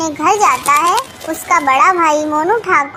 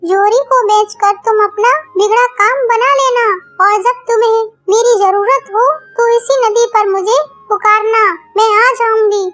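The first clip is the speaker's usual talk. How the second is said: higher, otherworldly serpent woman, grateful; grateful nagin speaking to Tunni in a higher, otherworldly voice; magical snake voice; 5 semitones higher than usual. Acted. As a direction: play the nagin thanking her rescuer. Snake explains the magic box.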